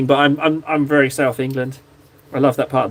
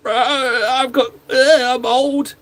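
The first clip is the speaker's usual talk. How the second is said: slow, croaky old-man put-on, pitched up; mock a creaky old grandpa, dragging the words out; hammed-up groaning old man; granddad voice; 7 semitones higher, much slower than usual. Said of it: "do I have granddad voice?".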